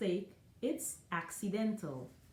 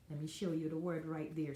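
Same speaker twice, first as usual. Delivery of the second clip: ordinary delivery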